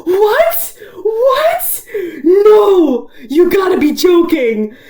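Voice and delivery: high, laughing, half-crying cry of disbelief; shocked disbelief. Retold or imitated. Fan reacting to Zubul saying Jax's name, shocked high cry. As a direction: cry out that it can't be true.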